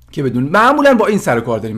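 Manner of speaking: ordinary talk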